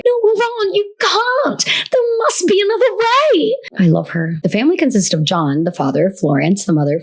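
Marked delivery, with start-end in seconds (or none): 0.1-3.6 very high, earnest British schoolgirl; Hermione. Acted. Hermione to Ron, high hammed-up British voice. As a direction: plead in a squeaky British accent. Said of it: "what does Hermione say?".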